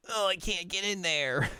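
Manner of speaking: high-pitched mock whine; hammed-up helpless whimper; whining kid. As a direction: complain like a thwarted little boy. Boy fails to jump in well.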